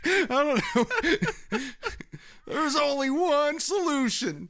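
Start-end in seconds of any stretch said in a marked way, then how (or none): 2.5-4.5 high, slow, hammed-up motivational speaker; Matt Foley impression. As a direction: play the over-the-top motivational speaker lecturing the kid. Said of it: "That's a pretty good impression".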